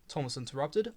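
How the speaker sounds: ordinary delivery, nothing marked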